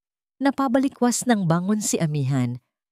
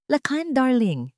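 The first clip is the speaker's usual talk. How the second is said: slow, honeyed greeting, pitched up; suddenly sweet; 7 semitones higher, slower than usual. Acted. A scheming fiancée greets the master sweetly. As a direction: sweeten the voice for the man she wants. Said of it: "Ang boses ay biglang naging malambing".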